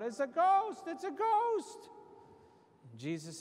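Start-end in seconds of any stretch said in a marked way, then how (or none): 0.0-0.8 a loud frightened cry, higher up; terrified yell. Retold or imitated. Disciples in the storm crying out in fright, loud yell. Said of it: "and they yelled out". 0.9-1.9 much higher, slower, drawn-out scream of terror; panicked shriek. Retold or imitated. Disciples seeing a figure on the water, high drawn-out shriek. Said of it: "and they yelled out".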